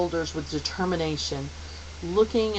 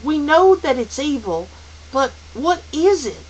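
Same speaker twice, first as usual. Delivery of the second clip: raised, louder insistent voice; high, impatient boy's demand; 9 semitones higher, 7 dB louder than usual. Acted. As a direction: press hard for an answer. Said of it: "Calvin demanded".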